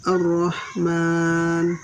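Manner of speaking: Ar-Rahman with the r held doubled, very slow. Shown as a demonstration. Teacher modelling tasydid on the r, slow Ar-Rahman. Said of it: "nanti kita baca".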